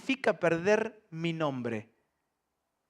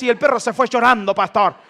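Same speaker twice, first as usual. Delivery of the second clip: louder, quick, triumphant telling; mock-proud believer telling the pastor the dog fled; excited boast; 6 semitones higher, 11 dB louder, faster than usual. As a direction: finish the story proudly, fast. Parishioner finishes excited boast.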